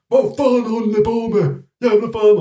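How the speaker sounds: high, loud outraged yell; acted angry screaming. Friend jumping out at Finns by the car, angry screaming. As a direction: scream at them as the furious friend, imitated. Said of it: "så började han typ skrika".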